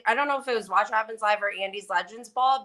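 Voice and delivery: usual speaking voice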